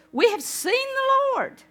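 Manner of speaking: high, loud, thrilled announcement; very excitedly. Acted. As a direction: burst out with joyful news. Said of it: "very excitedly".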